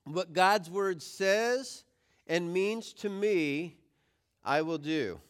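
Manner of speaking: slow, measured phrase for others to join; deliberate group recitation with pauses; leading a recitation. Shown as a demonstration. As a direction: lead the group through it phrase by phrase, slowly. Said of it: "say the power phrase with me".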